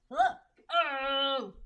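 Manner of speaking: sudden high loud Oh; startled cry at the pet; surprised oh. Real, not acted. Reader startled by her pet, a loud high surprised Oh.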